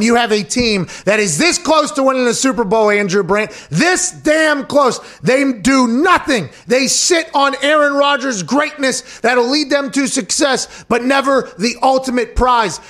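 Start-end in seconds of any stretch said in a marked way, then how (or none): 1.6-12.8 heated rant. Real, not acted. Heated rant about the Packers.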